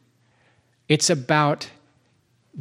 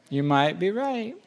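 teasing repeat; 4 semitones higher than usual. Shown as a demonstration.

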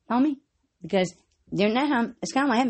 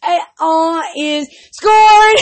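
slow loud judge call; slow announcement; 4 semitones higher, 8 dB louder, much slower than usual. Acted. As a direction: announce it like scoring.

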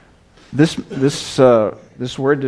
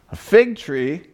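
higher and louder, a startled question; astonished cry; 7 semitones higher, 4 dB louder, slower than usual. Acted. Nobleman astonished at the old man planting a fig tree, surprised cry. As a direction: toss it back in disbelief. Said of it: "cried the astonished nobleman".

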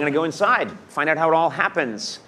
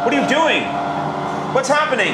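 alarmed, voice shooting up; 11 semitones higher, faster than usual. Real, not acted. Machine cutting meat.

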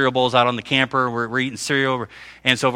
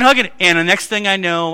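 ordinary delivery

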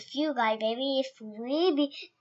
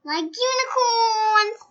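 slow, high, thrilled squeal; excited; 11 semitones higher, 5 dB louder, slower than usual. Real, not acted. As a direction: gush about unicorns. Child excited about unicorns.

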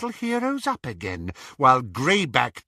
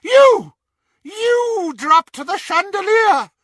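very high, loud, slowed accusation; furious screech; 8 dB louder, slower than usual. A narrator's reading. Bellatrix raging at Dobby, furious screech. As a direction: scream the accusation in fury.

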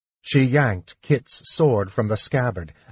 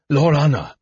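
higher, breathless mock gasp of a call; 4 semitones higher, 4 dB louder than usual. A narrator's reading. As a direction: call her name as if winded. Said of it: "He gasped, without breath to shout".

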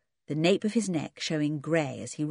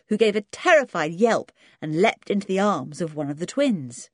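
usual speaking voice

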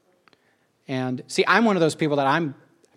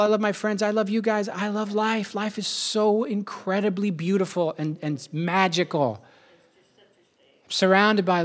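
near tears; 5 semitones higher than usual. Real, not acted. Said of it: "I'm just crying".

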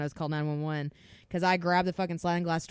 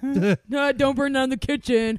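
higher, louder teasing warning; mock warning; 8 semitones higher, 9 dB louder, slower than usual. Imitating family teasing her whenever she cooks. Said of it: "it's always brought up".

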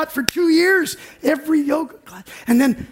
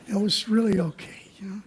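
whispered; 4 semitones lower, 7 dB quieter than usual. Real, not acted. Quiet admission.

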